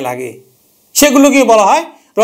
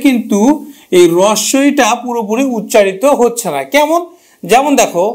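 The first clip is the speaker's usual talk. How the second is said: usual speaking voice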